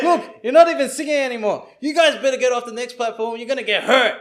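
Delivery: high, very loud mock threat; hammed-up threatening yell; confrontational drunk guy. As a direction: shout him down, imitating an angry drunk. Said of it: "he gets in the face of the singer".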